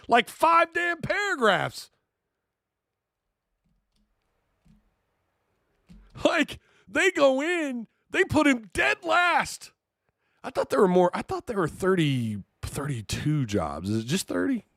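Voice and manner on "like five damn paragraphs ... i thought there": exasperated rant. Real, not acted. Ranting about the five paragraphs.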